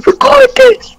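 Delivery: very high, squealed imitation; mock panicked yelling. A K telling the girl to record, mock panicked yelling. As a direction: mimic his frantic order in a shrieky pitch.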